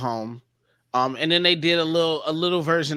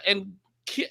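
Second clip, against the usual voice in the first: usual speaking voice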